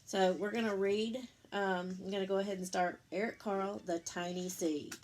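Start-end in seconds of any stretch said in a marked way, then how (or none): none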